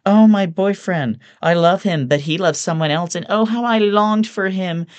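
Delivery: very high, loud swooning parody; mocking Camden girl. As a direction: mimic a lovesick college girl, tumbling into blah-blah. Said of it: "so sick of hearing Camden girl problems".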